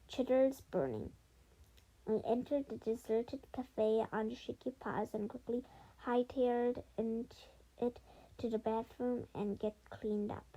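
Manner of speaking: ordinary delivery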